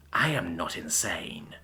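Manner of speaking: low, slow, menacing villain voice; deluded villain. Acted. Villain to hero, low slow deluded menace. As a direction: speak low like a mad self-made dragon.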